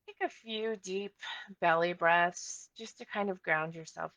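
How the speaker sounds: calm meditation guide with long pauses; unhurried body-scan narration; guided meditation. Acted. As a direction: ease listeners into their bodies, leaving space between phrases.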